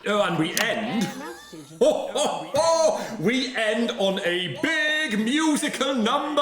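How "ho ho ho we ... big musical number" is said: jolly laugh. Acted.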